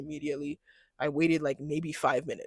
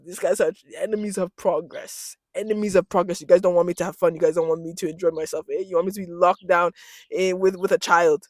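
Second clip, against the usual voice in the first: usual speaking voice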